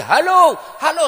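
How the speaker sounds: high, very loud imitated caller; mock phone shouting. Imitating Haji not hearing anything, loud mock shouting.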